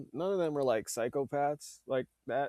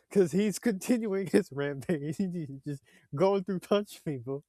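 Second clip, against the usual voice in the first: usual speaking voice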